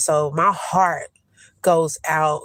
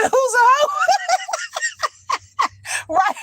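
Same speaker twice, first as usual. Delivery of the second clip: laughing outrage. Real, not acted. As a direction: shriek it through laughter. Mock offence, laughing.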